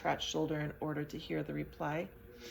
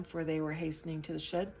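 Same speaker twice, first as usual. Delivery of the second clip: ordinary delivery, nothing marked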